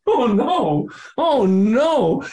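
panicked oh no. Retold or imitated. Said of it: "I'm going".

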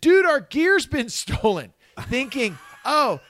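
high, loud, slow worried call; high-pitched mock alarm about stolen gear; retold naive panic. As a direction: play your naive past self, alarmed. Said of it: "called them and said".

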